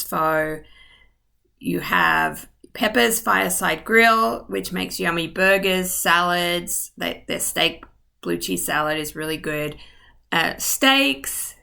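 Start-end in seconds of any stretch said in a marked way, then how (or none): none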